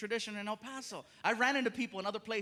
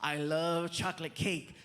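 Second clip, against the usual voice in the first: put-on quoting old joke; 4 semitones lower, slower than usual. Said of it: "I did a joke where I said".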